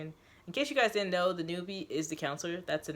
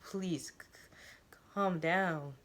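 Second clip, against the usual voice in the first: nervous stutter; much slower than usual. A narrator's reading. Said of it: "he stutters".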